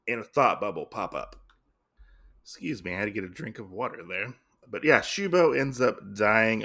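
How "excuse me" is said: usual speaking voice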